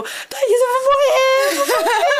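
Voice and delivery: high, slow wailing cry of fear, half laughing; mock panicked scream. On the roller coaster climb, to her friend Thaís: terrified laughing wail. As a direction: scream it out like you're sure the ride will kill you. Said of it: "E eu gritava".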